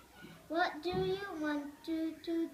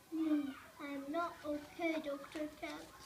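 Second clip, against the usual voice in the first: ordinary delivery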